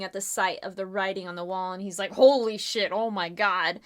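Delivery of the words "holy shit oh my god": shocked outburst. Acted. Lord Snow shocked by the ghost writing.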